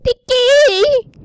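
high startled yell; loud startled shout. Real, not acted. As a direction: shout it out in sudden alarm. Startled by someone in game.

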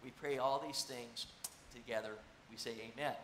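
low, hushed prayer voice. Acted. Pastor closing a prayer for the congregation, hushed and reverent. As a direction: pray it quietly and reverently.

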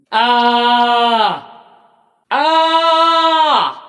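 high, held "aah" cry; long open-throated yell; sustained cry. Acted. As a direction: cry out on one long steady high note. Acted game cries, held.

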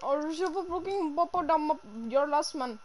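high-pitched put-on Indian accent; mock Indian accent. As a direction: play a threat in an exaggerated Indian accent. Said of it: "my Indian voice".

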